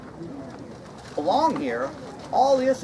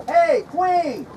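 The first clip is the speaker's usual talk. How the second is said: high, loud call across the room; summoning the Queen with a raised call; 8 semitones higher, 3 dB louder than usual. Acted. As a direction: shout for the Queen to come. Calling out to the Queen.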